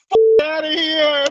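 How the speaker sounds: retold New York phone yell. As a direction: bark it like a guy yelling on the phone. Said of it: "and he goes".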